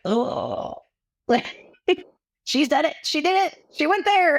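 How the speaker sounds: high, scandalized mock gasp; mock shock. Mimicking people shocked that someone said vagina, high mock scandal. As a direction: imitate prudes clutching pearls.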